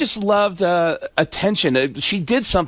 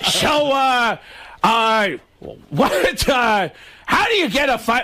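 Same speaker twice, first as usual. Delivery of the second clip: higher, squawky stalling comic voice; high-pitched comic fumbling into a joke; Gilbert Gottfried; 5 semitones higher, slower than usual. Acted. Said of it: "Do it in the Gilbert voice".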